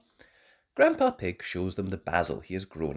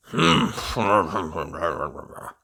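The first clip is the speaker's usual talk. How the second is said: laughing grandpa; 4 semitones lower, 7 dB louder than usual. A narrator's reading. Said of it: "says Grandpa Pig".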